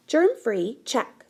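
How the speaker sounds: ordinary delivery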